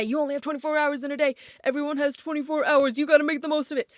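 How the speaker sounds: high, fast pushy go-getter; hustle culture voice. Retold or imitated. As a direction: rattle it off like a hustle guru. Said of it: "that tells you that".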